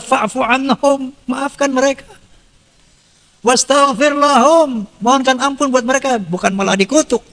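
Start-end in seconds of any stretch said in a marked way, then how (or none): 0.0-1.1 Quranic recitation. Acted. Quoting Quran verse. 3.5-4.8 Quranic recitation. Acted. Quoting Quran verse.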